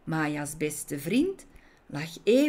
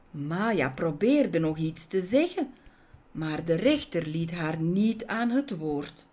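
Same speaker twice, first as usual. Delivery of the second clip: plain reading voice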